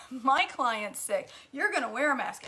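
a hint of an indignant salon owner; ranting nail tech. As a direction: rant like the fussy nail tech. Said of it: "he's ranting on and on".

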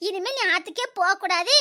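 ordinary delivery

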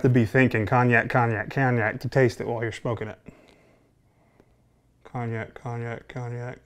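talking around pipe stem; speaking while puffing pipe; pipe in mouth. Real, not acted. Seen 1: Hand holds pipe stem at lips through most words, removed near end.

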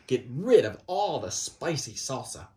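ordinary delivery, nothing marked

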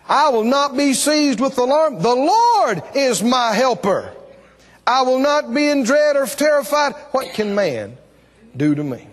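high-pitched, sustained preacher's declaration; bold scripture proclamation. Real, not acted. Preacher declaring the Lord is helper, bold preaching. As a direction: declare it boldly to the room.

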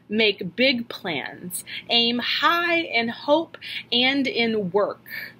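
slow, weighty and deliberate; a teacher's slow solemn advice; slow recited quote. Retold or imitated. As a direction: lay each word down slowly, like a maxim. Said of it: "She said".

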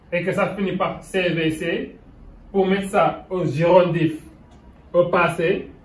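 ordinary talk

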